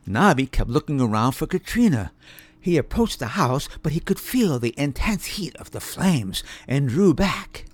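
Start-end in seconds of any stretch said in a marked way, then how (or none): none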